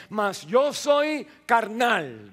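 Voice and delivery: "soy" lento y fuerte; lectura enfática. Real, not acted. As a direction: subrayar "mas yo soy carnal" despacio. Lee versículo con énfasis. ("carnal" alargado con fuerza)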